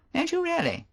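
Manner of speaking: teasing disbelief; Favell. Acted. Favell voiced.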